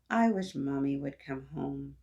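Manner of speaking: usual speaking voice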